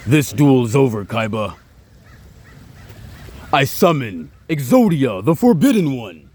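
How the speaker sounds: Pharaoh Atem voice. Retold or imitated. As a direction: command the duel like an ancient pharaoh. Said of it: "sound like Pharaoh Atem".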